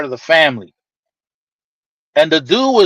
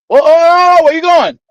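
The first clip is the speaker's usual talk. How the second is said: calling out; 9 semitones higher, 4 dB louder than usual. Retold or imitated. Family stopping him at door.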